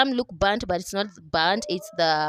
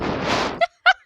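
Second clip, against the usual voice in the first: giggle. Real, not acted. Laughs about her ingredients.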